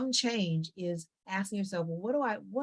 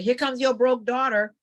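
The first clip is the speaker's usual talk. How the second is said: sneering family put-down, a bit higher and louder; mocking relative jab; 5 semitones higher, 7 dB louder than usual. Relative mocking the broke daughter at the door, sneering. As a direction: toss the insult at her like it's a joke.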